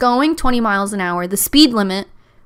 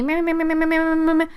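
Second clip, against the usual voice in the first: higher, repeated honking noise; imitated car honking; 7 semitones higher, faster than usual. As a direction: honk like an impatient car horn. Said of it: "she just starts honking".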